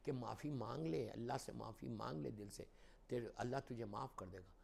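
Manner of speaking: gently hushed, tender counsel; soft and loving. Acted. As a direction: coax softly, with love. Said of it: "بڑی softly کہا، بڑے پیار سے کہا".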